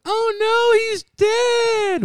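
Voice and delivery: high shrieking "oh no"; mock shocked shriek. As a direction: shriek it in fake horror. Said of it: "come home and be like".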